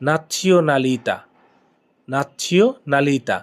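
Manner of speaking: "nazionalità" drawn out very slowly; teacher modelling "nazionalità" syllable by syllable; slow pronunciation demo. Shown as a demonstration. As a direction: stretch every syllable for the learner. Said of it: "Italian কি?".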